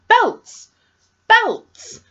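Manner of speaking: high-pitched exasperated disbelief; incredulous exclamation. Real, not acted. Reader ranting about a heroine looting a boutique, incredulous. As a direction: throw the word BELTS up high, appalled. Incredulous at character's useless haul.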